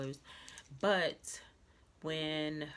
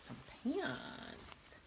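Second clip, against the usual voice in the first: whispered reveal; 8 semitones higher, 5 dB quieter than usual. Real, not acted. Dramatic pause, reveals second item.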